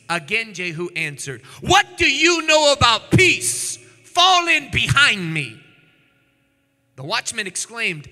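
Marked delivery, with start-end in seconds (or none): 1.6-5.6 defiant command. Acted. Jehu repeats defiant reply.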